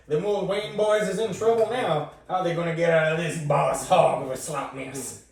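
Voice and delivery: loud, high Southern TV narrator; hammed-up cliffhanger voiceover; Dukes of Hazzard narrator. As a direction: spin it like a country yarn-teller. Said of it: "sounds more like a Dukes of Hazzard".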